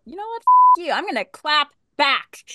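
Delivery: mock-defiant retort; retold defiance. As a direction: fire back as the fed-up target. Imagined defiant comeback quote.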